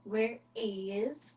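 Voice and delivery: normal talking voice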